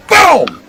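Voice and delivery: high, sudden boom noise; one high punch sound effect; imitated punch boom. Shown as a demonstration. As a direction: pop out a high mock impact.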